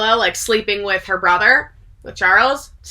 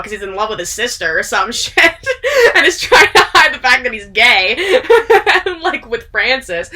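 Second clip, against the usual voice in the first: laughing; 3 semitones higher, 4 dB louder than usual. Real, not acted. Cracks up retelling the gossip.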